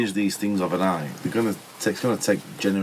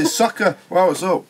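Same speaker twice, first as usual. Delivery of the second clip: much higher, chirpy mock-foreign "soccer"; weird put-on voice; 10 semitones higher than usual. Retelling the bar in South Africa, much higher put-on voice. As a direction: put on a silly accent to fit in. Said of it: "I don't know why I spoke like that".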